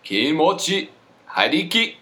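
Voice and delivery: loud, high, hammed-up fake Japanese; mock Japanese accent. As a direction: belt out made-up Japanese words. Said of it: "could you pronounce that for me?".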